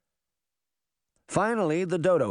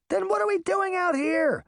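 put-on very high, exasperated boy; squeaky-high impatience; impatient outburst. A narrator's reading. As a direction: protest at the pointless waiting. Puck impatient to get going.

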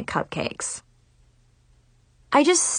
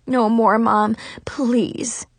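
ordinary delivery, nothing marked

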